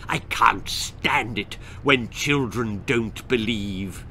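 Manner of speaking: slow low toad voice; Tongue Toad voice. Acted. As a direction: drag it out, grumpy old toad.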